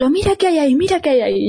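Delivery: high, urgent pointing cry; imitating sleepwalking sister's cry. Sister in her sleep shouting at the narrator, high cry. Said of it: "empieza a gritar, a señalar".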